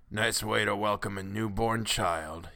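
put-on slow, feeble old man's voice; weak complaint. A narrator's reading. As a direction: grumble feebly, aged and weary. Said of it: "he complained in a weak voice".

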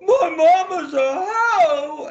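slow, high, loud indignant shout; mock outraged shout. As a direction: bellow it back, stretched out and offended. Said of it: "and I went".

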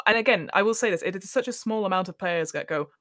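ordinary talk, nothing marked

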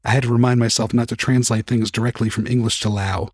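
ordinary delivery

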